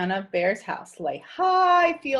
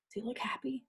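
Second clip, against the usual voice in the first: whisper; 13 dB quieter, much faster than usual. Real, not acted. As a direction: whisper it under the breath. Aside to listeners while reading.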